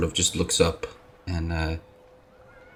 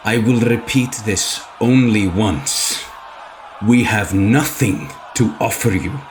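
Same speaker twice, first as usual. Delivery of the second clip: intimidating warning; 3 semitones higher, 5 dB louder than usual. Acted. Arthwin to the crowd, hand on blade, menacing. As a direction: threaten without drawing fully. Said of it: "half draws it".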